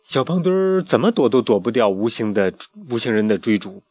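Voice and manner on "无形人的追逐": usual speaking voice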